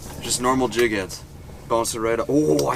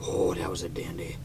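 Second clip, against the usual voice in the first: whispering; 3 semitones lower, 12 dB quieter, faster than usual. Real, not acted. Quiet ice shack catch.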